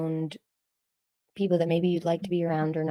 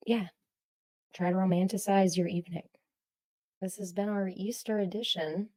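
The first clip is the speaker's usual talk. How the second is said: usual speaking voice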